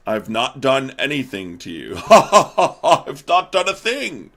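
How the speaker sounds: goofy laughing denial, climbing high and loud; Elon. Acted. Billionaire denying the vice president's accusation, mock laughing protest. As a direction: protest innocence through hammed-up laughter. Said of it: "then Elon comes in and goes".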